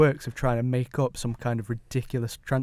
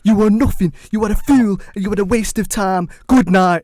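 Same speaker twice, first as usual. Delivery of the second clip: higher, much louder furious rant; Andy Tate rant; 7 semitones higher, 8 dB louder than usual. Retold or imitated. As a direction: bellow insults at the manager. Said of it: "I got quite passionate".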